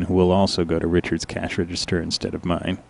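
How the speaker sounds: ordinary delivery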